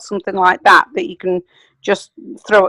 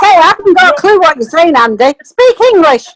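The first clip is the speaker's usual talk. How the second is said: high, louder mock exasperation; teasing exasperation; 8 semitones higher, 4 dB louder than usual. Real, not acted. Teasing a colleague over his accent, mock exasperated and high. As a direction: pretend you can't follow a word.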